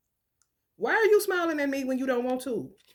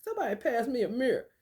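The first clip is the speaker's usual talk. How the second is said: normal talking voice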